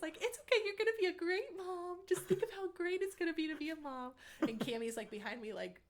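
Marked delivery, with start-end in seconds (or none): 0.2-4.1 high, loud, gushing reassurance; bubbly friend gushing over good news; excited gushing. Retold or imitated. Friend Caitlin gushing excitedly to a crying friend. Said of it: "really excited".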